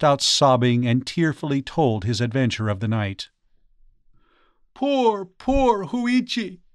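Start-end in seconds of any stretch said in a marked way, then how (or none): 4.7-6.6 very high, drawn-out cry of pity; pitying exclamation. Acted. Priest to his injured friend, high pitying exclamation. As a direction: exclaim with anguished sympathy, high and slow. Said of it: "the priest exclaimed".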